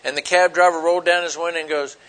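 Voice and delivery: usual speaking voice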